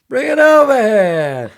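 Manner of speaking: hammed-up high, drawn-out yell; loud movie-quote outburst; De Niro in Raging Bull. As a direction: bellow it out, stretching the words. Imitating De Niro's Raging Bull outburst.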